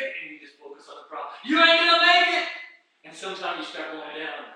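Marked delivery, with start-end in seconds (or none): 1.4-2.5 high, loud taunting shouts; Satan's taunting. Acted. Satan distracting the walker, loud taunting. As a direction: yell it to break the walker's focus. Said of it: "And Satan says".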